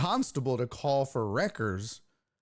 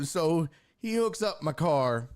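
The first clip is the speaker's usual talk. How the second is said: ordinary delivery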